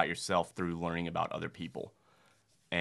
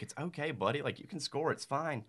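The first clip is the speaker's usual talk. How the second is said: softer, higher soothing voice; comforting; 6 semitones higher, 7 dB quieter than usual. Retold or imitated. Coach comforts a crying young player, soft and high. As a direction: reassure a crying little boy gently. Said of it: "And I was like".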